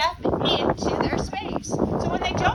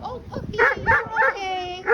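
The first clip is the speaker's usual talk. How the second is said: put-on babying coo; 5 semitones higher than usual. Shown as a demonstration. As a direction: coo like soothing a baby. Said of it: "Same when I want to baby a dog".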